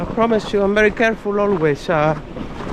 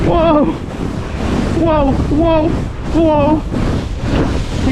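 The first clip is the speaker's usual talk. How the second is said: excited whoops; 7 semitones higher, much slower than usual. Real, not acted. Bumpy fast sled ride.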